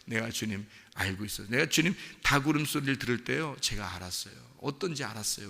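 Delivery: soft, low, heartfelt admission; humble confession. Acted. Peter confessing to the risen Lord, humble confession. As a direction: confess it quietly to your Lord. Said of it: "주님께 고백하는 거예요".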